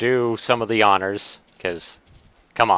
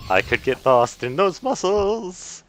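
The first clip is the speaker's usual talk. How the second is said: high lilting copy of the game line; tiny sing-song voice, echoing the clip; 8 semitones higher than usual. Retold or imitated. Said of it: "Including the little tiny sing-song voice".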